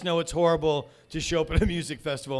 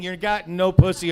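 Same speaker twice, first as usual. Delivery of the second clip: usual speaking voice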